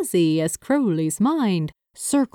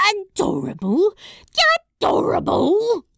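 high, incredulous echo, more outraged on the repeat; indignant scoff; 12 semitones higher than usual. A narrator's reading. Crowley objecting to being called adorable, scoffing. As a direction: throw the word back in offended disbelief. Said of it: "Crowley scoffed".

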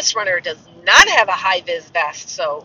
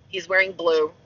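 ordinary delivery

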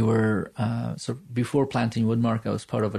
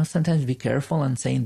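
ordinary talk

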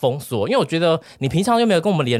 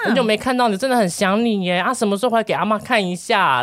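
lightly imitated doting grandma; 9 semitones higher than usual. Grandma on the phone asks grandson to visit, mildly imitated doting voice. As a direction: coax him home like a doting grandma, pitched up. Grandma says she misses him.

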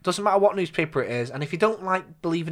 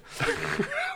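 high squeaky laugh; 5 dB quieter than usual. Real, not acted. Laughs at own joke.

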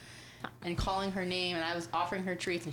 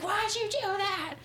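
much higher, slower scolding yell; mock angry yell; 14 semitones higher, 4 dB louder, slower than usual. Owner retelling yelling at her dog, mock angry. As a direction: yell at the dog like you're fed up. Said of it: "I get real mad and I yell at her".

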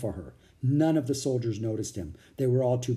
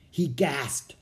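ordinary delivery, nothing marked

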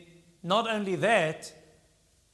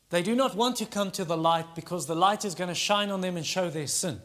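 ordinary talk, nothing marked

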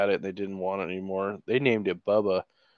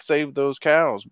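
slow, louder and higher chant; mock rally cry; 6 semitones higher, 8 dB louder, slower than usual. Joking about cheering for cows, slow mock rallying cry. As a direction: drag it out like a protest slogan. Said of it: "Instead of "save the whales," I'd be like".

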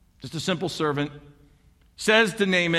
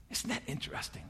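very soft, almost whispered; hushed aside; 5 semitones lower, 17 dB quieter, faster than usual. Real, not acted. Preacher to congregation after the healing, hushed aside. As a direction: drop to a near-whisper to let it sink in.

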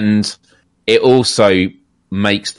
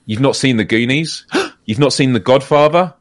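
mock-shocked gasp, question rising at the end; gasping disbelief; 5 semitones higher than usual. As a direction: act aghast at someone's confession. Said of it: "makes people gasp when I admit it".

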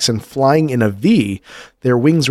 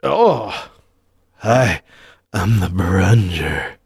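creature voice; 5 semitones lower, slower than usual. Pretend. Voicing the Burunjur creature.

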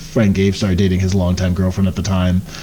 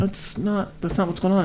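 high, soft protest; 9 semitones higher, 3 dB quieter than usual. Retold or imitated. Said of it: "And it's like".

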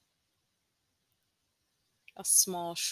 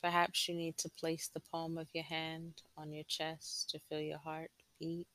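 guided meditation. Acted. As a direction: gently guide them inward, unhurried.

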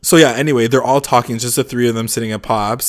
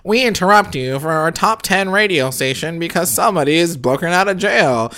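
put-on higher announcer voice on an old radio; radio alert; 6 semitones higher than usual. Host imitating the radio alert, higher announcer voice. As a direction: read it like a radio bulletin. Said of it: "And it's like".

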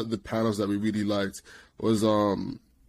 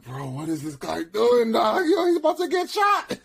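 rising to a very high squeal; mock alarm; 10 semitones higher than usual. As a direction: panic on a friend's behalf, climbing higher. Retold alarm at friend's risky move.

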